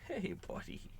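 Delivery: soft high whisper to a pet; pet talk. Real, not acted. Talking to the pet.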